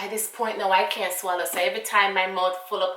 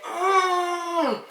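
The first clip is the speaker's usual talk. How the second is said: a much higher, crying "mm" of pain; pained whimper; 10 semitones higher, 3 dB louder than usual. Retold or imitated. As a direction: whimper as if the pill tears your throat. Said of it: "because that's how hurt it is".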